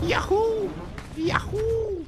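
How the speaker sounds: high whooping cheer; cheering yell. Real, not acted. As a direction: whoop with joy. Cheering jump in game. (grido di gioia)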